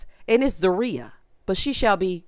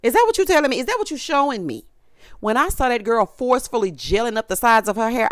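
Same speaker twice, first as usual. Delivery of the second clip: ordinary talk, nothing marked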